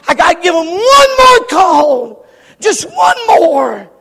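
high, loud, crying-out appeal; anguished preacher's shout; impassioned plea. Acted. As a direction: plead at full force for one last chance. God pleading for one more call.

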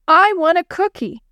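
put-on high, insistent child's demand; mild shout of a demanding kid. A narrator's reading. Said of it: "he shouted".

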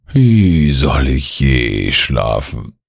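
slow, sad lament with a falling tune. A narrator's reading. Sleepless gnome lamenting to his visitors, sad. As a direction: draw it out sorrowfully, sinking from the first word. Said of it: "fragt der Waldschrat traurig".

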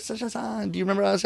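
high, soft voice of a polite fan; retold fan greeting. Japanese family greeting the performer in the lobby, high and soft. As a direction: imitate a family softly asking to be remembered.

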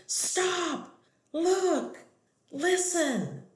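high, loud shouted cheer; cheerleader shout. Retold or imitated. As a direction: yell each word like a cheerleader. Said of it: "we would say in a loud shout and stomp our feet".

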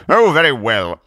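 loud, huffy surrender; exasperated crusader relenting; grudging concession. Acted. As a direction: give in with wounded dignity. Zealot gives in grudgingly.

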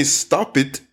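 "Stop it" with an extra IT syllable; mispronounced -ed. Shown as a demonstration. Teacher showing what sounds like stupid, extra syllable. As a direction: say "Stop it" as two beats, STOP-it. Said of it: "If you say".

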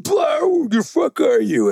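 very high, slow, bellowed brute voice; mock bar brute. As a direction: ham up the huge brawler hunting for someone. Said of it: "it's going".

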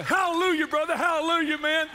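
very high, loud hallelujahs; hammed-up ecstatic praise; re-enacted jubilant shout. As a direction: whoop it out like a thrilled believer. Acts out overjoyed hugging and praising.

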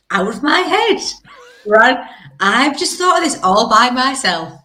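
high, smug self-congratulation; mock smug. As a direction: pretend it's all your own genius. Mock boasting as own idea.

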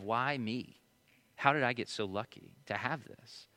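soft, low, awed thanks; grateful why me. Retold or imitated. Father amazed by his baby, soft grateful wonder. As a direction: marvel quietly at your blessing. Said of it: "a story of grateful "Why me?".

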